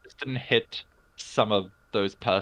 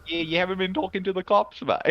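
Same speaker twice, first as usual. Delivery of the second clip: higher, laughing cockney-style try; mock British accent; 9 semitones higher than usual. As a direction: ham up a London geezer. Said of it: "Oi, bruv".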